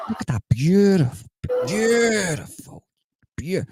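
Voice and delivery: high, long-stretched swoon on beautiful; gushing delight. Acted. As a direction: gloat rapturously, drawing out the vowels. Gloating delight at the scene.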